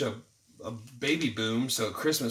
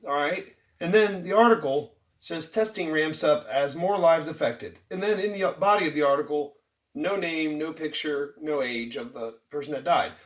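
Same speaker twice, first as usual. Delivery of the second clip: normal talking voice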